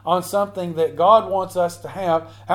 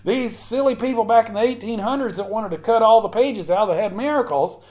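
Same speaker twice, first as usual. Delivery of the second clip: raised-pitch, impassioned pulpit delivery; fervent preaching; 4 semitones higher than usual. Real, not acted. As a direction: launch into an emphatic challenge to the congregation. Preaches at full force.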